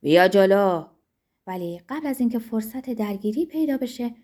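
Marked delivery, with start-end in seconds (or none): 0.0-0.8 loud, forceful dare; shouted challenge. A narrator's reading. Zorba leaping up to take on his rival, shouted challenge. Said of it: "داد کشید".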